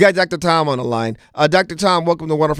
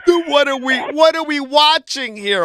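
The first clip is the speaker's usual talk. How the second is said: exasperated questioning; 12 semitones higher than usual. Real, not acted. Host exasperated, repeating his question.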